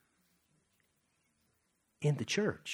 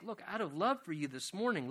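ordinary talk

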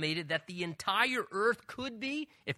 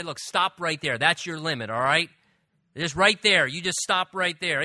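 loud, firm halt order, building louder; mock command to a wave; 8 dB louder than usual. Preacher pretending to order a wave to halt, loud firm command. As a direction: hold up a hand and command the wave to stop.

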